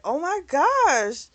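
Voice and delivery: high, slow, drawn-out gasp of disbelief; mock astonishment. Carolyn reacting to what happened, in high-pitched surprise. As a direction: imitate a friend's stunned reaction.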